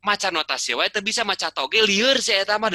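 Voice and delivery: high, lively teasing friend's voice; friends teasing in Sundanese. Retold or imitated. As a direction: poke fun at him like an old friend. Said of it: "teman-teman saya tahu".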